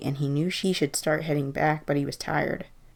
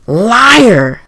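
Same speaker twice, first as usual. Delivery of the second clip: huge, high accusing roar; booming shout; 7 semitones higher, 18 dB louder than usual. A narrator's reading. Chorus of voices condemns the old man, booming shout. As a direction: roar the accusation with full force. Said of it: "the voices boomed".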